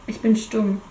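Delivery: ordinary delivery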